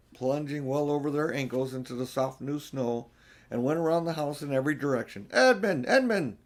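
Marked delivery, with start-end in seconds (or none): 5.3-6.4 higher, louder calls of a name; calling out. Acted. Children search the snow calling for Edmund, shouting. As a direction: call across the snow for him. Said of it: "they called till they were hoarse".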